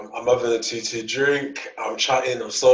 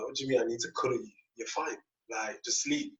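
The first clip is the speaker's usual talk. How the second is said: rushed and much softer, almost under his breath; hushed fast brush-off; 11 dB quieter than usual. Retold or imitated. Dismissing the girl's cuddle request, fast and hushed. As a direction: hurry through it quietly.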